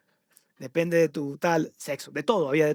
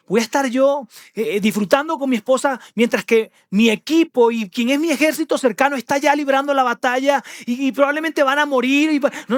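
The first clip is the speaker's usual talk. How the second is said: earnest refusal; 6 semitones higher than usual. Acted. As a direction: protest to the king that you couldn't possibly. Uriah refusing the king.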